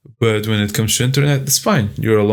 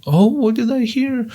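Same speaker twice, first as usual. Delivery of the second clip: high mock fairy question; 13 semitones higher than usual. Fairy hearing the macho man, high and light. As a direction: lilt it up high, curious fairy. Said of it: "a fairy or something".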